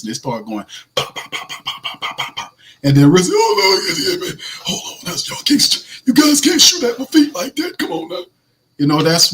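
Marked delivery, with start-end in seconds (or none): 0.9-2.6 soft unvoiced pop pop pop; whispered gunshots. Reporters firing questions like gunshots, whispered pops. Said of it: "like Yosemite Sam at his feet". 3.3-8.2 high, flustered raspy coach. Retold or imitated. Coach ducking reporters' shots, flustered raspy impression.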